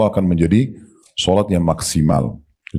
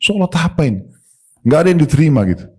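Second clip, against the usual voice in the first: indignant; 8 semitones higher, faster than usual. Real, not acted. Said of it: "scolding rhetorical".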